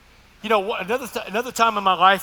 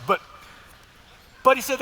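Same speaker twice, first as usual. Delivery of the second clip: normal talking voice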